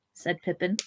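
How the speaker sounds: ordinary delivery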